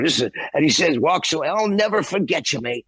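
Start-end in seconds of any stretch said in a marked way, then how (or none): 1.5-2.8 high, grateful Cockney gush. Retold or imitated. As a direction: gush thanks like an emotional drunk rocker. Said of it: "I'm not doing it that great".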